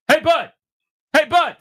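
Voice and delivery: loud, high mock call; imitating a call. Host imitates the tough guy calling to the man at the bar, loud and high. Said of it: "who goes".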